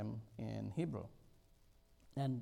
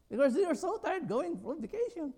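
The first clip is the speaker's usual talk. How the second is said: much higher and louder outburst; exclaiming; 7 dB louder than usual. Real, not acted. Preacher to congregation, exclaiming in high loud disbelief. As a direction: burst out in disbelief. Sermon exclamation about tired vacationers.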